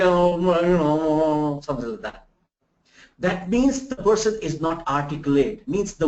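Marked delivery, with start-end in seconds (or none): 0.0-2.3 lazy mumbled interview filler; mumbling captain. Retold or imitated. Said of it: "he would say".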